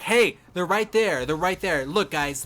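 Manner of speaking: loud urgent callout; calling out. Retold or imitated. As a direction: shout to the team, pointing. Teammate calling out enemy.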